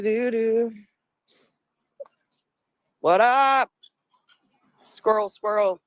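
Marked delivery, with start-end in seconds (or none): none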